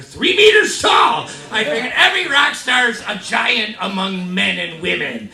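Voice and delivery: loud, booming exaggeration; emphatic hype. Real, not acted. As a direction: belt it out like a tall tale. Exaggerates rock stars' size for laughs.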